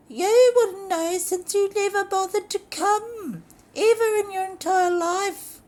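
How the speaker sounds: high, loud falsetto lady voice; accusing diva. Acted. Lorraine accusing her husband of never coming, shrill. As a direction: scold him shrilly.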